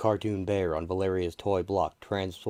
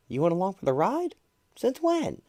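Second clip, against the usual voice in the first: surprised; 13 semitones higher, 5 dB louder than usual. Acted. Johnny's surprised question.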